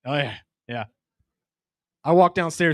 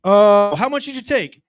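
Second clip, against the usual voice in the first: high, loud startled dad; dad hearing his son took shrooms; mock surprise; 4 semitones higher, 4 dB louder, slower than usual. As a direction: act caught off guard, then curious. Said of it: "I'm like".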